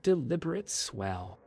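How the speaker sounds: plain reading voice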